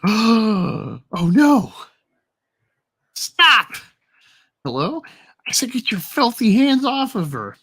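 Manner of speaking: high, loud panicked pleading; alarmed cry. Acted. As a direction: scream at him to stop. Waitress alarmed in comic.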